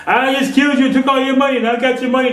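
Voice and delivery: a high, loud mock brag from an imagined killer; boasting robber. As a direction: crow over the stolen money, hammed-up.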